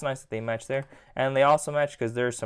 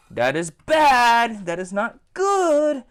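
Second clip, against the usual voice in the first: exaggerated disapproval; 7 semitones higher, 6 dB louder, slower than usual. Real, not acted. Dismayed at missing peg holes.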